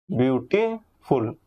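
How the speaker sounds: "Beautiful" slowly in two parts, beauty, pause, फुल; split into syllables. Shown as a demonstration. Teacher showing students syllables, "beautiful" slowly broken in two. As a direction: break "beautiful" with a clear pause before फुल. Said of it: "आप एक बार में बोल ही नहीं पाओगे".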